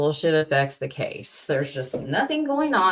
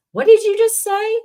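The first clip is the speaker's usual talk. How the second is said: much higher, louder stunned cry of disbelief; 不敢相信地高声惊呼; stunned outburst; 6 dB louder than usual. Real, not acted. Outraged at hypocrisy.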